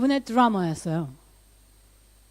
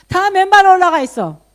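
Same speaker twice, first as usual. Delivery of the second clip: higher and louder, astonished; amazed; 8 semitones higher, 10 dB louder than usual. Real, not acted. Pastor marvelling to the congregation at the barefoot worship team, excited. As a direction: burst out in amazement.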